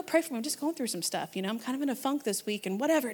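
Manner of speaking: hushed, low-key honesty; quiet confiding. Retold or imitated. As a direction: ask privately, keeping it low. Acting out quietly asking for prayer.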